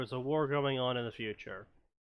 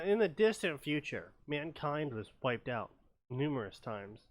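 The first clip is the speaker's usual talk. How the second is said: lightly dramatic narrator voice; old sci-fi announcer. Acted. Reads fanfic intro dramatically.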